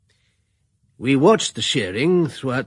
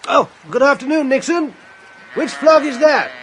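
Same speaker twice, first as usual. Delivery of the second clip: hearty greeting; 12 semitones higher, 5 dB louder than usual. Acted. Narrator voices a hearty local greeting.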